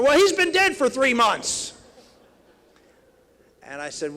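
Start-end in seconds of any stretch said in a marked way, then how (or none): 0.0-1.7 put-on high, loud, slow old lady retort; indignant old woman. Old woman tells the candidate her husband died, high loud retort. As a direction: snap it back like an offended widow.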